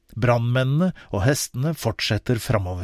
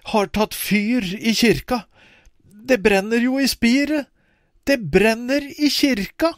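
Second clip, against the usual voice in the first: alarmed; 8 semitones higher than usual. Acted. Ivar tells the family the church is on fire, alarmed. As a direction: point and shout the news out.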